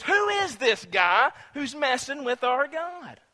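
high, slow, incredulous demand; acted indignant challenge. David indignantly challenges Goliath's taunts.

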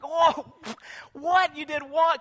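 high, loud shocked outburst; a brother hearing the worst news; stunned disbelief. Acted. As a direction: shout it in disbelief. Reuben learns Joseph was sold.

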